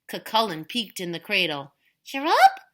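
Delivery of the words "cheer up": put-on baby voice. Huge baby speaks.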